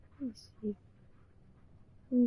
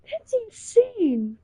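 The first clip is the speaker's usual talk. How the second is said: ordinary talk, nothing marked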